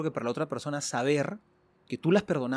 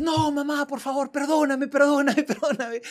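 put-on high, whiny begging kid voice; pleading child; 9 semitones higher, slower than usual. Punished son begging his mother to forgive him, high and whiny. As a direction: beg mom like a little boy.

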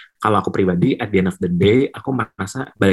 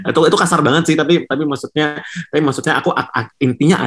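higher-pitched, just out of a laugh; amused backpedal; 6 semitones higher than usual. Real, not acted. Guest excusing his own blunt wording to the host, amused backpedal. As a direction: recover from laughing while softening what you said.

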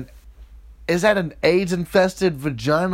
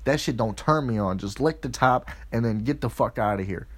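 quieter, lower put-on grumble; low, soft mock partner; 9 semitones lower, 8 dB quieter than usual. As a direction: keep her voice low and hushed. Partner's voiced complaint goes on.

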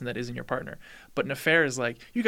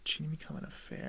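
hushed musing repeat; whispered echo; 2 semitones higher, 11 dB quieter than usual. Real, not acted. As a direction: whisper it back, puzzled. Pondering repeat.

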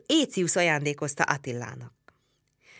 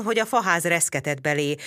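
ordinary delivery, nothing marked

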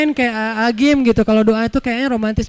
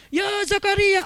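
mock shouted call; 10 semitones higher than usual. Shown as a demonstration. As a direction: call it out the wrong way, high and strained. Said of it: "Dan itu nggak usah diteriakin".

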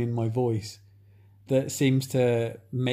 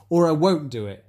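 ordinary delivery

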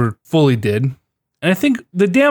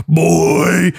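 lightly imitating the buddy's "Boy"; buddy's catchphrase; 3 semitones higher than usual. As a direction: mimic the buddy's one-word call. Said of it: "listen to that man say".

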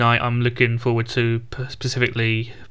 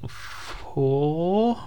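"four" stretched very long, rising at the end; drawn-out suspense; 5 semitones higher than usual. Pretend. Announcing Stranger Things season, "four" stretched out and rising. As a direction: hold "four" for seconds and slide it upward.

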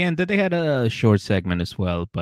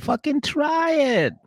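much higher and slow, an insistent mocking order; exasperated demand; slower than usual. Wife voiced ordering her drunk husband to try, high and slow. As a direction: draw it out high like a fed-up wife.

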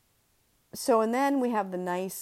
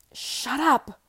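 loud, higher snap of an inner voice; called out sharply; 9 semitones higher, 7 dB louder than usual. Acted. Inner voice telling her to shut up, loud. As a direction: bark it like a voice in her head. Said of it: "another voice called".

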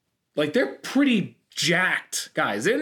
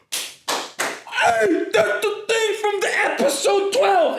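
very high, mock-thrilled squeal; overexcited fan; 3 dB louder, much slower than usual. Fan spotting a show reference in line, mock overexcited squeal. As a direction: squeal it as if it's the biggest deal ever. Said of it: "they would make the biggest deal of it".

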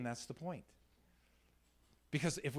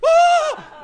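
very high, loud mock scream; startled scream; 22 dB louder than usual. Scared man jumped at an ATM, high startled scream. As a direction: shriek like a startled robbery victim.

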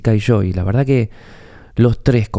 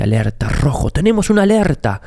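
alarmist news voice; 4 semitones higher than usual. Retold or imitated. Mimicking news banner alarm.

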